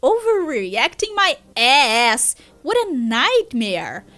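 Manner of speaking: slow, swooping, drawn-out complaint; indignant. Acted. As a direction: scoff and stretch the stressed words theatrically. Character indignantly disagrees.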